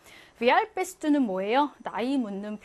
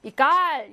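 이 깔 with the weight on 깔; stress on second syllable; 5 dB louder, much slower than usual. Shown as a demonstration. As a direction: hit the last syllable of 이 깔, 깔. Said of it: "강세가 뒤에 있습니다". (이 깔, 뒤 음절 깔에 강세)

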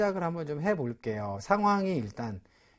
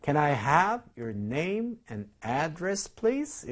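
very slow, every word spaced, big rise on "please"; slow model reading; much slower than usual. Shown as a demonstration. Teacher repeating the clerk's question for students, very slow. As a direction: read it out slowly for the class. 이렇게 얘기했습니다.

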